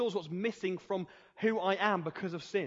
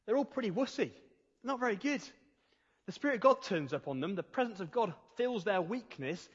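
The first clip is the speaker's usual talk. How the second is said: usual speaking voice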